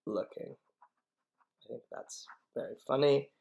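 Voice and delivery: usual speaking voice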